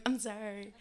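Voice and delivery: a guilty little apology; sheepish apology. Retold or imitated. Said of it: "I'm like".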